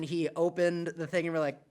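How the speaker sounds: normal talking voice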